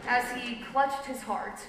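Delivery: ordinary delivery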